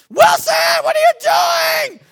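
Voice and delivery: high, loud, drawn-out yell; aunt's outraged shout. Retold or imitated. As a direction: shriek it across the room at him. Aunt walks in on the mess.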